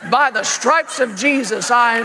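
parent leading a boy in a hammed-up confession; imitated faith-shout; loud confession. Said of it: "Say it out loud".

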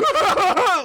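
high, imitated burst of laughter; sudden laugh outburst. Comedian showing his outburst in bed beside wife, sudden laughter. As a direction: crack up out of nowhere. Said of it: "and I just went".

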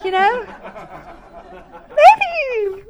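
laughing, high-pitched hedging; mock nervous evasion. Wife dodging the visitor's question, high nervous evasion. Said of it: "And I said".

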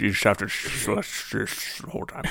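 soft, repeated "sh-, sh-" to show the slurred s; "sh-" hissed over and over; slushy Sean Connery sibilants. Shown as a demonstration. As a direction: turn every "s" into "sh". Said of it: "trying to do a Sean Connery".

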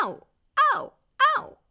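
repeated sharp yelps of pain; short pained "ow" cries; wailing. A narrator's reading. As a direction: yelp out in separate bursts. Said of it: "Hij jammerde zo luid".

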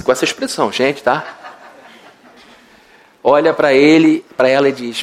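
normal talking voice

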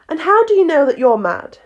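Alice, challenging. A narrator's reading.

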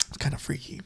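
hushed whisper. Retold or imitated. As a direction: breathe it like a secret. Retold reaction to close-ups.

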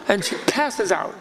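normal talking voice

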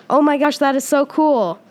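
high, loud delighted gush; excited cat. Acted. Ralphie the cat thrilled to hear the mouse's name, loud and high. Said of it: "Ralphie responded".

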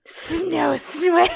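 hissing the words through laughter, high and loud; laughing hiss attempt. Shown as a demonstration. Friend demos how Danny hissed, laughing hard. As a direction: make the S's hiss while cracking up. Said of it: "How do you hiss that?".

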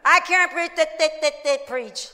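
stuttering on the th before preach; mock stutter. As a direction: imitate a stutterer getting stuck. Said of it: "we'll start to stutter".

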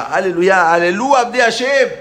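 loud, rising praise; jubilant proclamation. Acted. Freed people start praising God, jubilant proclamation.

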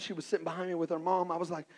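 ordinary talk

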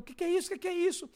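high-pitched repeated cry; retold shouting. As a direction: imitate the man yelling in shock. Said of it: "ele começou a gritar". (grito agudo imitado, atônito)

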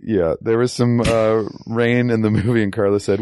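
usual speaking voice

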